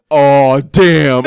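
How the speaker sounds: loud, drawn-out mock dismay; hammed-up imitation of a doom-saying friend; exaggerated dismay. Passenger inspects the car for dad on the phone, exaggerated dismay. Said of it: "Jung makes it ten times worse than it really is".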